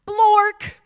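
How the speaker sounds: loud high "Blork" blurted out; nonsense word. Pretend. Reading the silly word aloud to the kids, loud and high. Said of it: "even if the words say".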